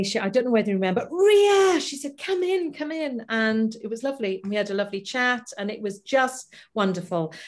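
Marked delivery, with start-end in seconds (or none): 1.1-3.2 [name] greeting. Retold or imitated. Greeting the singer at the door, a high welcoming call. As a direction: welcome her in warmly.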